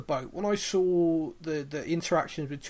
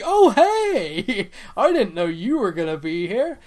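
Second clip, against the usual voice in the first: much higher, loud, laughing fake greeting; fake surprise; 3 semitones higher, 8 dB louder, slower than usual. Ezekiel greeting Carol off the boat, hammed-up surprise. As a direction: overdo the casual surprise.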